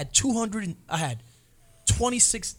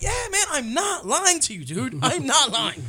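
pleading; 8 semitones higher than usual. Retold or imitated. Pleading to lawyer.

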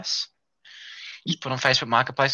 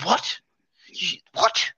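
shocked mum; 10 semitones higher, much slower than usual. Retold or imitated. Mum shocked at low price.

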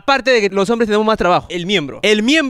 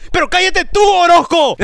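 higher, louder shout back in jest; joking yell back; 7 semitones higher, 3 dB louder than usual. Real, not acted. Host yells back at his cohost, playful shout. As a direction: fire it right back at him.

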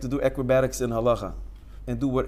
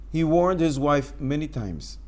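ordinary talk, nothing marked

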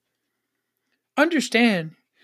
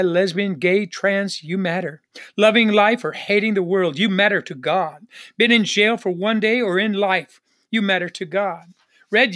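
ordinary talk, nothing marked

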